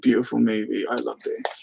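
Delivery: usual speaking voice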